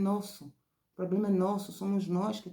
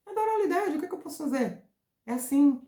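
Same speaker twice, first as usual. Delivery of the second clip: much higher, a little louder, playful squeal; squeaky high; 11 semitones higher, 5 dB louder, faster than usual. Shown as a demonstration. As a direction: squeal it out like an excited kid. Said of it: "Presta atenção à minha entonação". (voz bem aguda e brincalhona)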